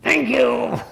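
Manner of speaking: high, bright-spirited thank-you; grateful thanks. Shown as a demonstration. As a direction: say it with real gratitude. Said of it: "you're gonna go just like this".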